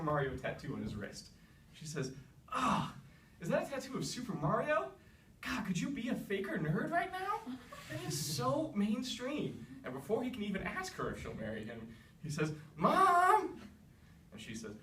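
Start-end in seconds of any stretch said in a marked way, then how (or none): 2.5-9.7 high, sneering hipster voice, slowing right down at the end; mock him like a snob; snooty princess. Snobby princess mocks his tattoo. 12.8-13.6 high, loud call for mom; whiny yell. Acted. Prince calls for mom.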